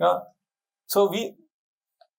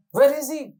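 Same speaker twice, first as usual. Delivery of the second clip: much higher, startled cry; alarmed; 10 semitones higher, 3 dB louder than usual. Acted. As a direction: shoot the question up in alarm. Bharat's alarmed question.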